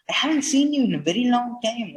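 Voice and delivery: eager reproach. Shown as a demonstration. Bassanio to friends he misses, fast and eager. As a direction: rush it out like a hurt friend.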